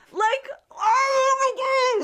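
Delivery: very high, slow, laughing cry of disbelief; outraged laughing shriek. Real, not acted. Friend reacting to a movie assault, laughing shriek. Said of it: "outraged at the scene".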